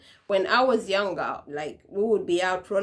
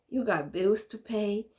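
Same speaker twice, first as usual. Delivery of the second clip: ordinary talk, nothing marked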